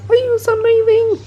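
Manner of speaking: squeaky high pitched pet talk; high baby voice to pet. Pretend. Repeats it to the dog squeaky high.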